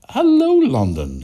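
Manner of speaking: high, slow drawn-out greeting; mock hello. Podcaster greeting a listener's city, high and drawn out. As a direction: sing out a big hello to London.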